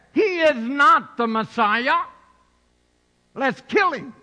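loud, harsh mock decree; mob leaders' cry. Leaders condemn Jesus before the crowd, loud and harsh. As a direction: condemn him loudly.

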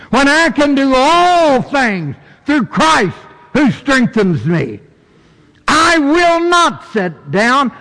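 high, ringing pulpit delivery; preaching at full force. Acted. Preacher answering feelings of inadequacy with scripture, preaching at full force. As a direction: drive each phrase home from the pulpit.

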